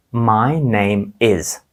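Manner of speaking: very slow, each word apart in "My name is"; slow demo. Shown as a demonstration. Teacher models the phrase slowly so children can repeat. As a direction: drag out "My name is" for learners. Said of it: "Скажіть це".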